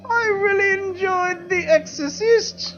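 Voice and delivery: put-on high, slow quavering old lady; a wobbly old English dame; old English woman voice. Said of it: "speak in old English woman voices".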